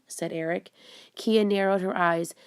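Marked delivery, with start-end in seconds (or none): none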